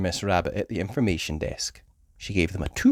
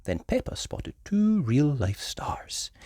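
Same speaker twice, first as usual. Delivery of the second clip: usual speaking voice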